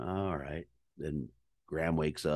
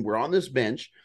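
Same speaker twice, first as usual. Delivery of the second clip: ordinary talk, nothing marked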